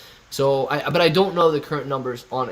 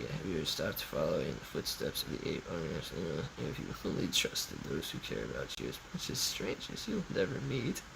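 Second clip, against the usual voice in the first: much lower, much softer, eerie voice; hushed low mock-ominous read; spooky low reading; 13 semitones lower, 16 dB quieter than usual. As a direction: read it like a creepy oracle.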